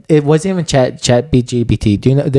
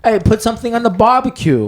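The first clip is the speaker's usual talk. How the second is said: much higher, hammed-up Australian accent; bad Australian impression; 10 semitones higher than usual. Cohost demonstrating his bad Australian accent, hammed up high. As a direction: play a deliberately bad Australian, voice pitched way up. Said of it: "let me be bad at impression".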